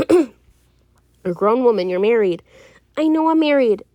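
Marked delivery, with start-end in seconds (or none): 3.0-3.8 raised, higher voice in real exasperation; exasperated. Real, not acted. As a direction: insist loudly, tired of being told. Told again what they already know.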